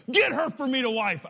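high, emphatic demand to a father; demanding son. Acted. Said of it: "even as I asked the question".

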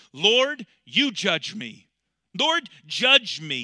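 David begging God aloud, loud and drawn out; pleading cry. Acted. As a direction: cry out slowly, begging for judgment. Voicing David's plea to God.

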